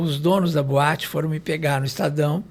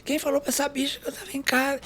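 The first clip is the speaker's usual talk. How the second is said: hammed-up high scream of outrage; mock scream; 10 semitones higher than usual. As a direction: screech it like a furious diva. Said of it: "ela começou a gritar".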